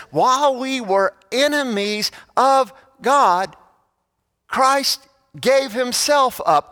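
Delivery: high, slow, fervent scripture reading; preaching emphasis. Acted. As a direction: lean into every word, especially gave.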